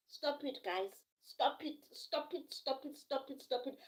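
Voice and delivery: soft, repeated plea to stop; hushed, insistent appeal; quiet appeal. Real, not acted. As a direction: say it under your voice, over and over. Repeated appeal to quarrelling elders.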